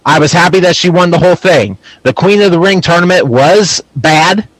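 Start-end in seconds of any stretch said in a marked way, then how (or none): none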